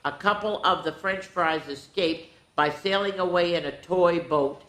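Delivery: plain reading voice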